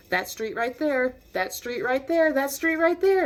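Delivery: increasingly frantic repeated direction; nervous urgency. Retold or imitated. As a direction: imitate a nervous examiner, building in alarm. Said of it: "getting kind of fidgety, kind of nervous".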